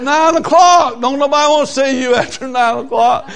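high, loud, chuckling through it; laughing reply. Retold or imitated. Preacher laughing off the song leader's zeal, amused. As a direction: laugh him down about the hour. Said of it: "I said".

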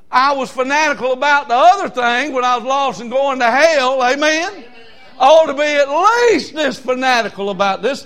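high, loud, driving preaching; full-force preaching. Acted. Preacher railing at his church about football fans, loud high preaching. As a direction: preach it hard and high to the rafters.

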